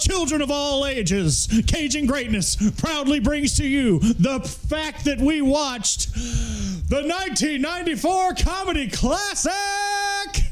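booming circus announcer, high and bright; ringmaster. Acted. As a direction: announce it like a big-top ringmaster. Birthday movie announcement, circus style.

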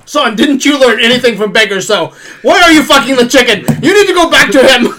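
scolding kung fu master; stern master voice. Pretend. Mock master scolding son.

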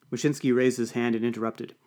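ordinary delivery

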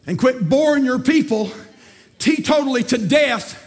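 fired-up preacher shouting to the church; preaching at full force. Acted. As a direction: whoop it up from the pulpit.

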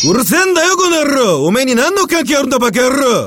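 high loud Japanese yakuza yell; angry yakuza shout. Acted. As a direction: bark insults in Japanese. 외계인 역 일본어 욕. (높은 야쿠자식 일본어 고함)

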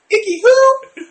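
high-pitched mock kid question; puzzled kid. Mimics kids' puzzled question about Iggy.

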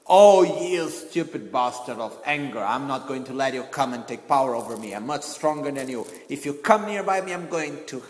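loud defiant challenge to anger; stern, forceful mock threat; acting angry. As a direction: shout down your own anger. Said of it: "really acting".

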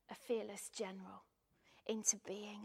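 normal talking voice

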